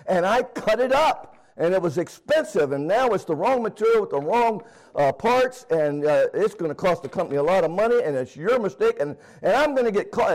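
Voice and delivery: high, scolding rant; mock angry woman. Angry coworker scolds him over wrong material, high mock scolding. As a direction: play the furious little redhead chewing him out. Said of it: "she is mad as she can be".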